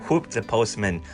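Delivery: ordinary delivery, nothing marked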